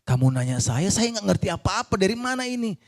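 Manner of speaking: mock protest in his head; exasperated inner thought. Husband silently protesting to the doctor, exasperated. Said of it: "Dalam hati saya".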